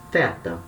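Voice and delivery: "teta" begun with an English th, like "theta"; tongue between teeth for the start of "teta"; low, soft th in "teta". Shown as a demonstration. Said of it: "do tipo do inglês".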